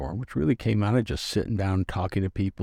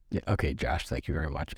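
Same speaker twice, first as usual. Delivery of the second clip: dismissive; 5 semitones lower, 6 dB quieter than usual. Retold or imitated. Brushing off Josh's praise.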